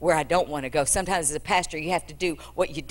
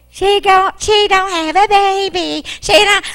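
much higher, louder mock sing-song; mocking Gehazi; 14 semitones higher, 10 dB louder, slower than usual. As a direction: mimic him scornfully, slowly. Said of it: "You're the one who said".

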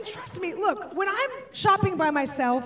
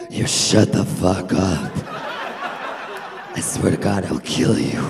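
demonic growl, far lower and louder; satanic voice; 5 dB louder than usual. Acted. Mom threatens her screaming son, deep demonic growl. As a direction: growl it from the pit of hell. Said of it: "lower satanic register of my voice".

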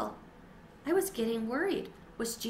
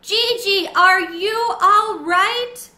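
much higher, louder, slow drawn-out shout; 10 semitones higher, 12 dB louder, much slower than usual. A narrator's reading. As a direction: bellow it out with full breath. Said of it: "took a deep breath and shouted".

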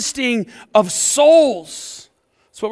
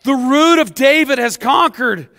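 booming proclamation; 4 semitones higher, 5 dB louder than usual. Retold or imitated. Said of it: "one of the elders said to me".

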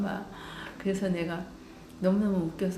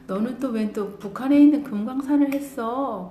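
loud, higher incredulous question; exasperated disbelief; 5 semitones higher, 9 dB louder than usual. Retold or imitated. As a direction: throw up your hands at the answer. Mother exasperated at daughter's answer.

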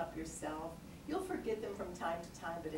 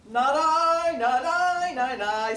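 much higher, loud crowing not I; loud rooster cry; 8 semitones higher, 13 dB louder, slower than usual. Acted. Teacher voices the rooster refusing to help, loudly. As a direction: crow it out like a proud rooster. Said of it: "said the".

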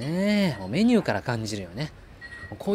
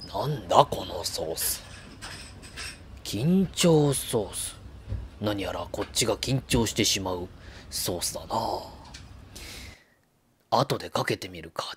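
low, slow, lightly voiced inner-monologue gourmet; Goro narration voice; 3 semitones lower, 4 dB quieter than usual. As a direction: muse to yourself like a lone gourmet. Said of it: "五郎さんのナレーション風でお送りしたい".

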